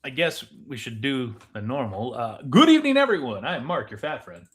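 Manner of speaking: ordinary talk, nothing marked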